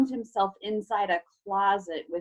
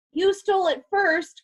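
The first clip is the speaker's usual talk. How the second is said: higher, sharp retort; a boy snapping at the villain; snapped; 9 semitones higher than usual. A narrator's reading. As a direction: snap it back at him defiantly. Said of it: "Carter snapped".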